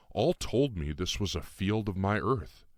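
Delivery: ordinary delivery, nothing marked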